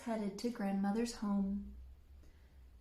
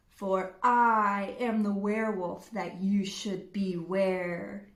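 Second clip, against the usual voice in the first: loud menacing monster voice; werewolf reveal; 4 semitones lower, 7 dB louder than usual. Acted. Red revealing herself as werewolf, loud and monstrous. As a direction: roar the reveal.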